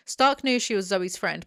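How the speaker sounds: normal talking voice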